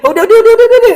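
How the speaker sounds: high, loud, pleading udah over and over; pleading neighbour. Retold or imitated. Neighbour begging a rolling child to stop, high and pleading. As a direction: coax a tantrum child to stop, hurried and high.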